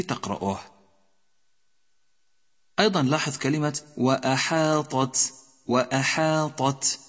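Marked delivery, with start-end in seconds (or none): 4.0-7.0 وأحاطت intoned with heavy ط, then light ت; distinct Ta and ta. Shown as a demonstration. Teacher modelling the word under study, وأحاطت with separate ط and ت. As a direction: keep ط and ت apart in وأحاطت. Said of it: "فرق في النطق بين الطاء وبين التاء".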